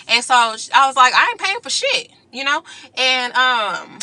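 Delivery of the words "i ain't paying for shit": high, defiant "I ain't paying for shit"; pitched-up refusal. Retold or imitated. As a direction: throw it back at her. Refusing to pay.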